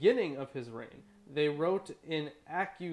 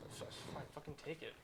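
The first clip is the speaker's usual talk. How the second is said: muttered aside; 16 dB quieter than usual. Real, not acted. Aside while passing the dog.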